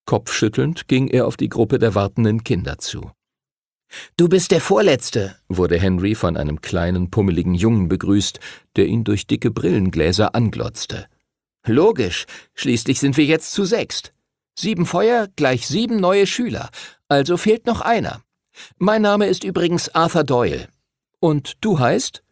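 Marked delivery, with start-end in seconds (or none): 4.2-5.4 put-on a raised, eager young boy's voice; nerdy boy voice. A narrator's reading. Arthur greets Henry, a nerdy boy's raised voice. 11.6-22.2 put-on higher, eager know-it-all boy voice; nerdy boy Arthur. A narrator's reading. Arthur the nerd introduces himself to Henry, high and eager.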